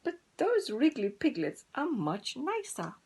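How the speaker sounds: envy the piglets in a high hen voice. Acted. Sad hen comparing.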